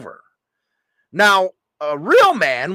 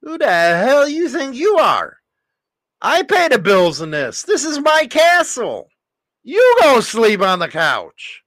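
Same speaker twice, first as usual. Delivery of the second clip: high, punchy man-of-the-house retort; a husband firing back at his wife about the couch; defiant comeback; 5 semitones higher, 3 dB louder than usual. Shown as a demonstration. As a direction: bark it back like it's your castle. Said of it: "you gotta come back and say".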